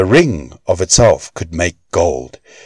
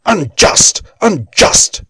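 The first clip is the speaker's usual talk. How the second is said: much higher, sharp outraged cries; Andvari shouting; 11 semitones higher, slower than usual. A narrator's reading. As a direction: fling the word like an accusation. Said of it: "shouted Andvari".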